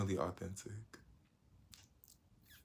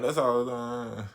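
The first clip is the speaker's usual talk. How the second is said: ordinary talk, nothing marked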